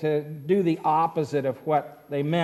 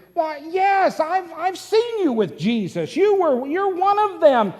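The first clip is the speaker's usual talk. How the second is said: high accusing bystander; 11 semitones higher, 6 dB louder than usual. Acted.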